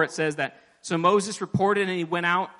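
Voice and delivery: ordinary delivery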